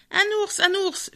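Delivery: high, excited little-bird voice; sparrows' cry. A narrator's reading. Sparrows crying out that a bear has come, high-pitched alarm. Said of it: "firent les moineaux en poussant leur".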